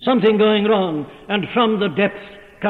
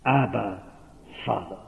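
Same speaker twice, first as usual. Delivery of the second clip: softer, lower, falling; hushed cry; 8 semitones lower, 8 dB quieter than usual. Acted. Preacher voicing the adopted child, soft and low. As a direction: say it tenderly, almost to yourself. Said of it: "that makes us cry".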